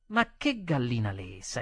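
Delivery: usual speaking voice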